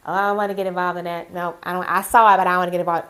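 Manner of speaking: hammed-up impression of a postal worker who won't get involved; mocking reluctant coworker. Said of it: "y'all don't like getting involved".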